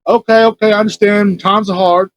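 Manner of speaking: a hint of an imitated street beggar; crackhead panhandler. Storyteller imitating the crackhead accepting no, lightly voiced panhandler. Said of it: "this crackhead out there".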